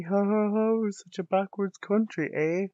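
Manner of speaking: high, loud fake laughter into a jab; mock laugh. Mocking his own country.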